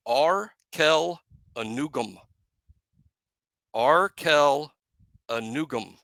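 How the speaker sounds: Arkel Anugum said slowly, AR-kel a-NOO-gum; slow Arkel, then Anugum with NOO; careful pronunciation. Shown as a demonstration. Host teaches the backup tackle's name, slow and careful. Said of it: "That's the proper pronunciation".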